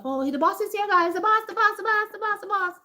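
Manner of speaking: high-pitched, frantic repeated warning; mock panicked workers. Workers warning each other the boss is here, mock panic. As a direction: imitate staff scrambling as the boss arrives.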